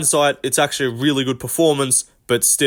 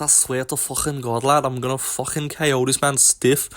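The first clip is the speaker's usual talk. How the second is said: mock Scouse accent; 4 dB quieter than usual. Mimicking fighter's trash talk.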